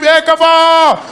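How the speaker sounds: high, loud happy shouting; joyful street shout. Retold or imitated. Drivers greeting each other on the street, happy shout. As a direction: yell greetings from a car.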